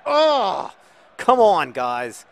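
loud, high burst of frustration; exasperated groan-shout. Real, not acted. Manager fed up with his guys, exasperated shout. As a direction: explode in exasperation.

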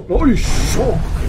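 high, loud, slow oh; shocked outcry, drawn out; startled exclamation; surprised cry. Real, not acted. Surprise at game event.

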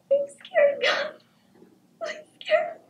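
high, tearful frightened whimper; a small child crying in fear; scared crying. Acted.

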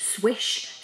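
usual speaking voice

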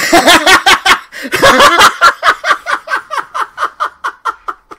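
long, very high cackle; hysterical laughter. Real, not acted. Streamer remembering the fake visitor, laughing hard. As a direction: relapse into helpless laughing.

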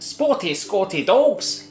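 slow, put-on Scottish accent. Shown as a demonstration. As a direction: draw it out in mock Scottish. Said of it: "doing it in a Scottish accent".

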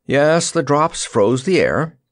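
high, slow bawled reply; drawn-out projected call; bawling. A narrator's reading. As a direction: bawl back over rushing air. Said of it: "the Wizard bawled back".

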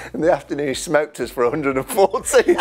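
laughing while telling the punchline. Real, not acted. Recalling the hundred and forty, laughing. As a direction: laugh through the words. Teammate's big innings retold, laughter.

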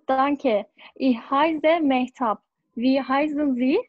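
usual speaking voice

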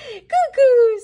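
high, loud sing-song cuckoo; bright bird call for a toddler. Acted. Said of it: "says the bird".

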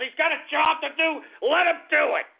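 high, indignant yell; angry telling-off. Real, not acted. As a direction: snap it out, high and forceful. Angrily telling off the callers.

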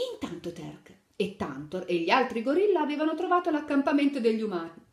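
put-on lively read-aloud to little children; animated picture-book narration; storytelling. A narrator's reading. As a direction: tell the fairy tale brightly to kids. Story narration; slip on degli.

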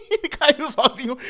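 high, slow laugh-through words; laughing at a fall; chuckling it out. Real, not acted. Laughing at fallen player.

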